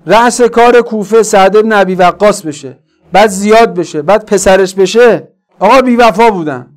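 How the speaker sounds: loud, high, mounting indignation; full-force preaching. Real, not acted. Preacher tracing Kufa's rulers to explain betrayal, full-force preaching. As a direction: hammer each name home to the congregation.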